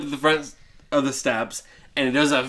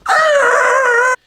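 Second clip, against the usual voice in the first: very high, loud shriek; hammed-up burglar scream; startled shriek; mock scream; 7 dB louder than usual. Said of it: "they go".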